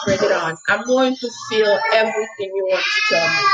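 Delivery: ordinary delivery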